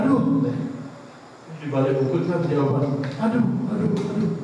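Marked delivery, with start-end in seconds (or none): 0.0-0.5 sharp high cry of pain after slipping; pained yelp. Acted. Boy slips on a rock and cries out in pain. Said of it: "Dia bilang". 3.2-4.3 echo. Acted. Echo repeats from beyond the hill.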